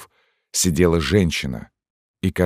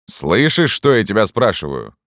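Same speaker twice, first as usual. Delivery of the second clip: stern demand; 5 semitones higher, 3 dB louder than usual. A narrator's reading. Sergeant presses interrogation.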